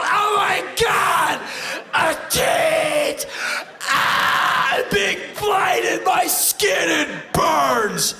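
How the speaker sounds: shrieking hammed-up horror, high and loud; over-the-top pearl-clutching shriek; mock horror. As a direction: scream like someone blinded and burning. America's mock horror at the breast.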